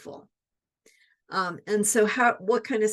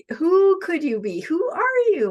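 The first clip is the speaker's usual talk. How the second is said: much higher, bright and open; delighted wonder; 7 semitones higher, 6 dB louder than usual. Shown as a demonstration. Coach inviting listeners to wonder, high and eager. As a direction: marvel at the possibility. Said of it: "isn't that a great question?".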